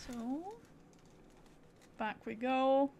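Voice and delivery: ordinary talk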